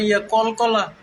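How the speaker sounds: ordinary delivery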